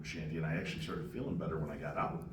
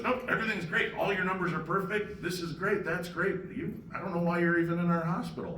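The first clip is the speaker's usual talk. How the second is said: much higher, louder doctor voice; imitated upbeat doctor; 10 semitones higher, 4 dB louder than usual. Doctor clearing a patient of trouble, imitated upbeat verdict. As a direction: reel off good results as an astonished doctor. Acting out doctor giving good results.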